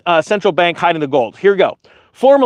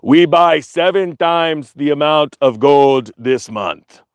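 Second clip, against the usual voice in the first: slow, lower, a hint of a Russian accent; 5 semitones lower, slower than usual. Russia boasting about gold buying, in a clumsy Russian accent. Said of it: "I don't even know how to do Russian".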